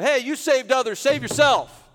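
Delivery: loud, high taunting shout; jeering yell. Acted. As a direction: yell it as hostile rulers heckling. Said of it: "the rulers yelled at him".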